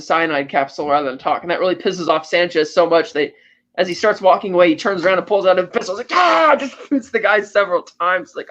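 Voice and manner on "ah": short high yell of fury, acted out; a retold outburst, higher and louder; mock rage yell. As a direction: bark out a sudden furious cry. Sanchez shooting the man in rage.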